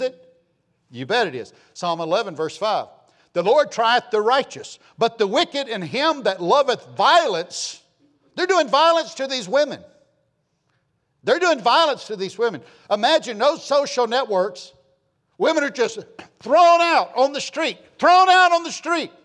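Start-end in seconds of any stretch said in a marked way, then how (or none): 3.3-12.6 loud, raised preaching voice; preaching at full force. Acted. Preacher crying out against violence to women, loud. 12.9-19.1 loud, raised preaching voice; preaching at full force. Acted. Preacher crying out against violence to women, loud.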